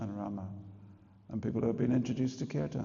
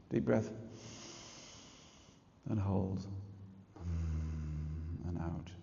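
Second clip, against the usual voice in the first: guided breathing, hushed at the out-breath; breathing instruction; 5 semitones lower, 9 dB quieter than usual. Shown as a demonstration. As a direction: talk them through a breath, gently fading.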